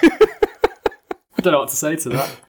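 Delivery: slow words through laughter; laughing. Real, not acted. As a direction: laugh while you answer. Laughing at the breath joke.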